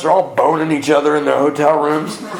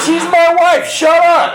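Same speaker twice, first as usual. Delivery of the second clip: laughing retort; 4 dB louder, slower than usual. Retold or imitated. Retort to nurse's question.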